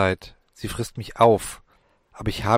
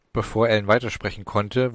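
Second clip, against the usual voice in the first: ordinary delivery, nothing marked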